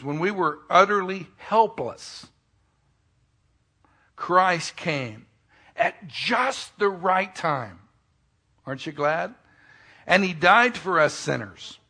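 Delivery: scripture reading. Acted. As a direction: proclaim the verses to the church. Reading scripture aloud, raised.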